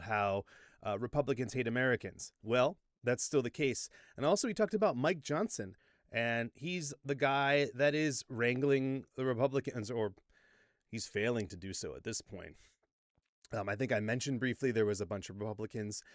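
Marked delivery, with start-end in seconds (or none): none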